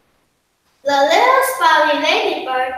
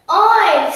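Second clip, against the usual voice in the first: loud piggy oink; 3 semitones higher, 4 dB louder than usual. Acted. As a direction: grunt out an oink. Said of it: "said the hog".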